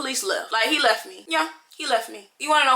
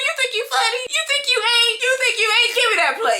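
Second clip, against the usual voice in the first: high, teasing sass; mock her for thinking she was funny; sassy mock; 11 semitones higher than usual. To her mom after the joke, high teasing sass. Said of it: "I'm like".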